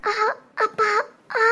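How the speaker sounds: short tearful moans; crying in pain. Acted. Labouring woman alone, trying not to cry, in pain. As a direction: whimper through a labour pang. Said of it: "அழுகாத".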